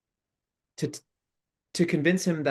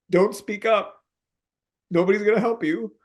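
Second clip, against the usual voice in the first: tearful; 9 semitones higher, 4 dB louder than usual. Real, not acted. Still tearful.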